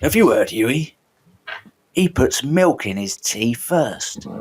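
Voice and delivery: slow Cockney character voice, not quite gravelly; Billy Butcher impression. Co-host gossiping to Huey about tea, slow mock Butcher voice. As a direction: put on a slow, conspiratorial London voice. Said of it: "be more gravelly than that".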